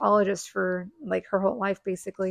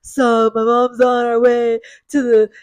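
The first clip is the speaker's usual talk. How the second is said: loud, slow, stuffed-nose imitation; comic nasal kid, dragged out and loud; nostrily voice; 9 dB louder than usual. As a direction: talk through a completely blocked nose. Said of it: "the nostrily kind of voice".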